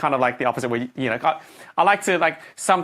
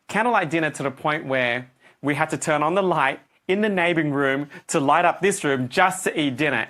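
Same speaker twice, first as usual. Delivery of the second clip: ordinary talk, nothing marked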